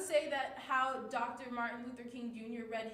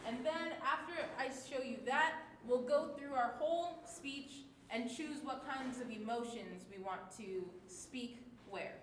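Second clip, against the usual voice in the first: ordinary talk, nothing marked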